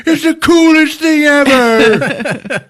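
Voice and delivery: very high, loud, drawn-out cry; comically anguished yell; heartbroken mock wail. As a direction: wail in hammed-up despair. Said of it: "looks fucking heartbroken".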